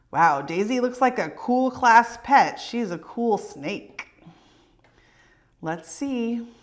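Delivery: ordinary delivery, nothing marked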